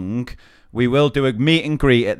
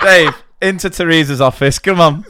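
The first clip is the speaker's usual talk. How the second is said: raised, higher summons; calling out; 4 semitones higher, 4 dB louder than usual. Acted. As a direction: call Dave in sternly. Calling the coach driver in.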